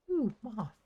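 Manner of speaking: high, loud squeal of delight; light up at spotting something fun; delighted surprise. Real, not acted. Spots a moth in game.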